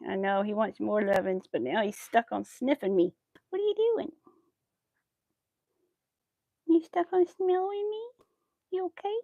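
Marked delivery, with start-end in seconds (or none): 3.5-9.1 high cooing questions. Real, not acted. Owner asks her sniffing dog if it's okay, high baby talk. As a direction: coo at the dog sniffing you.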